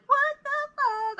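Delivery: very high, drawn-out disbelief; mock outrage. Finding the list in his pocket, a high outraged squeal. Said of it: "And I was like".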